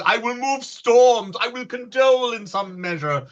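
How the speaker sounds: lofty. Acted. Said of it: "Oh, this was lofty".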